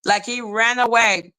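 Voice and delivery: usual speaking voice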